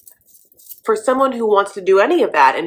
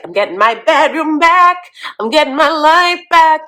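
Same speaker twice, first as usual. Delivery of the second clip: gleeful Bo; 8 semitones higher, 5 dB louder than usual. Retold or imitated. Host voices Bo relieved.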